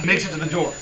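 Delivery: usual speaking voice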